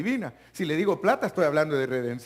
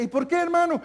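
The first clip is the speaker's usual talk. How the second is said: mock question; 13 semitones higher, 4 dB louder than usual. Acted questioning.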